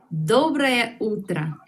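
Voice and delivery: Доброе утро said slowly and clearly; slow teacher model of Доброе утро; slow model phrase. Shown as a demonstration. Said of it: "por la mañana". (despacio, para repetir)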